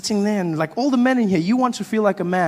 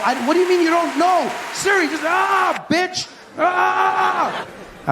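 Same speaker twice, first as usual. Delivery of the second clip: much higher, strained shriek of frustration; squealing meltdown. Retold or imitated. Shrieking at Siri as the comic loses patience. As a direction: lose it completely at the phone.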